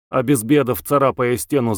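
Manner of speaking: ordinary delivery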